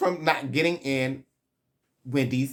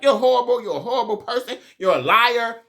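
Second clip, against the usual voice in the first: high, loud hammed-up accusation; pitched-up mock outburst; overemotional mock yelling; 8 semitones higher, 5 dB louder, faster than usual. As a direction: imitate someone too worked up to stop. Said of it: "way too emotional at this moment".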